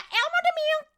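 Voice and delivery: high, loud put-on voice; goofy mispronounced product name; silly voice. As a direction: play the name in a cartoon voice. Mangling product name playfully.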